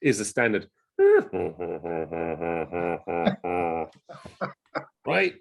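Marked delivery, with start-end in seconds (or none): 1.0-3.8 low, breathy hippo huffing; deep bubbly hippo. Shown as a demonstration. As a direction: huff out a classic hippo call. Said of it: "that is your classic hippo".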